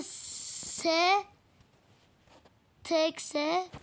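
normal talking voice

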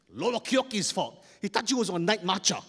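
put-on loud, high tattling kid; acted defensive boy; indignant blame. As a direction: blame your friend loudly. Boy blames his friend.

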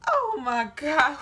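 slow, loud, stunned gasp of words; drawn out in disbelief; shocked oh my God. Retold or imitated. To herself, realizing the boys are brothers, loud and slow shock. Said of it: "I was like".

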